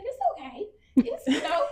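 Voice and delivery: high, gentle mock counselor comfort; soothing counselor. Patient retelling her counselor's reassurance, high soothing voice. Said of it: "She just said".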